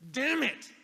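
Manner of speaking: loud high furious outburst; angry curse. Retold or imitated. Pastor alone after a miserable week, loud angry curse. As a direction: explode in frustration, throwing the briefcase. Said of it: "I threw it down and went".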